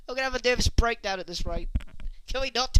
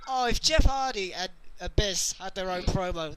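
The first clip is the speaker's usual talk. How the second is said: ordinary talk, nothing marked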